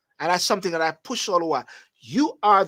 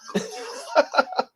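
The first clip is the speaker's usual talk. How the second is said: breathy laugh; 9 semitones higher than usual. Real, not acted. Laughs after cuss joke.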